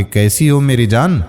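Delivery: usual speaking voice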